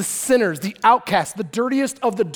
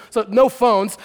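normal talking voice

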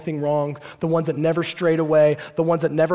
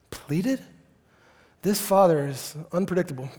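ordinary talk, nothing marked

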